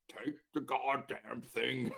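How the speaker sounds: slow, gravelly cursing; gruff patient's drawn-out demand; deep growly voice. Retold or imitated. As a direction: growl each word out slowly, fed up. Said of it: "deep growly voice".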